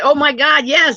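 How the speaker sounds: high, loud re-enacted delight; excited. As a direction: act out an overjoyed yes. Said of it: "I get excited about simple little stuff".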